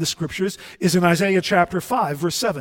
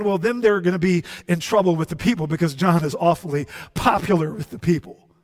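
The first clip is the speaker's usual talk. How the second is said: ordinary talk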